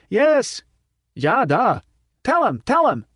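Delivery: high, excited shouts; crowd cheering. A narrator's reading. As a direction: cheer him on wildly.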